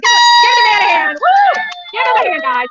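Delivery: loud call to the crowd; rally them to cheer; crowd hype shout. Real, not acted. Streamer shouting for the crowd to cheer the old man.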